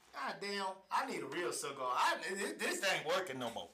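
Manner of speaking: ordinary talk, nothing marked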